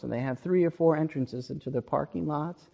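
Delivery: ordinary talk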